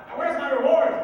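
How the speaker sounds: loud, high, greedy demand; demanding yell. Acted. Grumplebuffin demands his reward from the little people, shouting. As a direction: belt out the demand.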